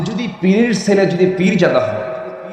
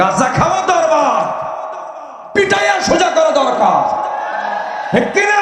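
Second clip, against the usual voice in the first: fiery preaching shout; 11 semitones higher than usual. Acted. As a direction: thunder it at the crowd. Preaching at full force.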